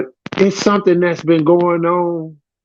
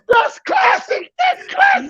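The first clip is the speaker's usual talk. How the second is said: laughing. Real, not acted. Bursting out laughing.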